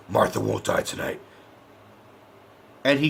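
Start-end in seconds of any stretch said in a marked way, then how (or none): 0.1-1.2 low, soft put-on hero voice; Batman. Reviewer imitates Batman setting off to save Martha, low solemn impression. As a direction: make the vow in a low Batman voice. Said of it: "Batman goes, he says".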